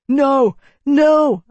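a high loud desperate shout. A narrator's reading. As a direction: shout it out in panic. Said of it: "I shouted".

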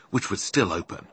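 ordinary delivery

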